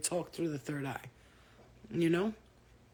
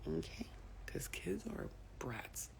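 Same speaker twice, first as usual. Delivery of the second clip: whisper; 11 dB quieter than usual. Real, not acted. Whispering while child naps.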